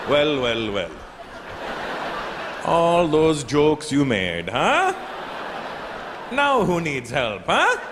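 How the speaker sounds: slow, smug, rising Indian taunt; gloating Indian accent. Retold or imitated. Agent taunts the comedian for his jokes in a gloating Indian accent. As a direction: relish the revenge in an Indian accent.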